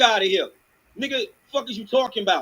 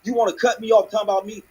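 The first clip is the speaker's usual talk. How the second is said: ordinary delivery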